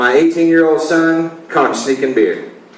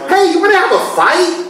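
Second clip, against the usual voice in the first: much higher, mocking feisty wife; 11 semitones higher than usual. Comedian translating wife's question into a dare, high mocking voice. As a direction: parody the wife picking a fight.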